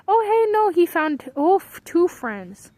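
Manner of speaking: high, loud burst easing as it goes; sudden excited outburst, settling down; excited discovery. Real, not acted. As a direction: exclaim it, surprised and thrilled. Delighted as ant finds friends.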